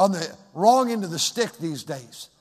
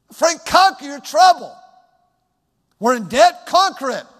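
loud, commanding call to conquer debt; a firm divine command, raised loud; voice of God; 6 semitones higher, 9 dB louder than usual. Retold or imitated. God speaking to the pastor.

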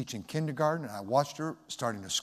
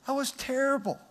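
voice breaking; 12 semitones higher than usual. Real, not acted. Preacher to the congregation, admitting how spanking hurt him, voice breaking. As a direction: voice cracking up high, holding back tears. Repeats it, still choked.

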